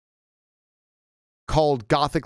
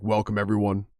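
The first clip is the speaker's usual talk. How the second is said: lower, quieter deep-voiced lecturer; deep voice impression; 5 semitones lower, 3 dB quieter than usual. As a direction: imitate a towering serious teacher booming welcome. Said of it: "he does, like, really deep voice".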